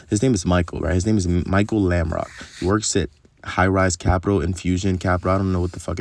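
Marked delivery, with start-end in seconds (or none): none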